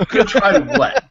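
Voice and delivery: high, loud, breaking into laughter; amused outburst of disbelief; laughing disbelief. Acted. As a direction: laugh through the bewildered question. Repeat with laughter.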